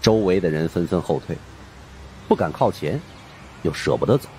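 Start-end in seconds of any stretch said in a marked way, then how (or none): none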